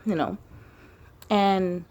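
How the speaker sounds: normal talking voice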